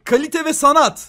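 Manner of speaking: grandiose. Pretend. Grand mock praise.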